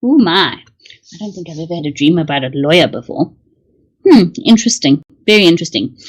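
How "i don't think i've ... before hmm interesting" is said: ordinary talk, nothing marked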